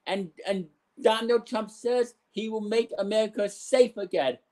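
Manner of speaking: ordinary delivery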